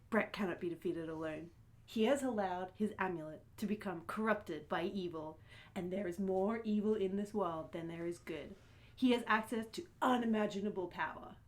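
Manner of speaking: solemn speech. Acted. [name]'s solemn in-character speech.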